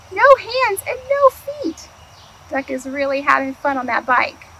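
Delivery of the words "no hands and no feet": high, thrilled exclamation; excited. Real, not acted. Reader amazed at Duck's trick, high and excited.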